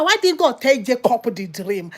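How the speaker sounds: usual speaking voice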